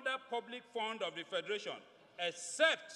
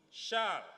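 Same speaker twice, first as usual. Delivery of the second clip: shall punched a little louder, falling; emphasis on shall; 4 dB louder than usual. Shown as a demonstration. Reading the law to colleagues, shall stressed. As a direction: hit the word shall. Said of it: "again, emphasis on shall".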